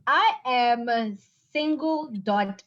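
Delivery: usual speaking voice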